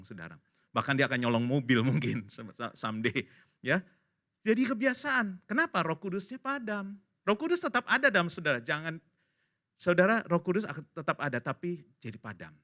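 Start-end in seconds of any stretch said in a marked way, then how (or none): none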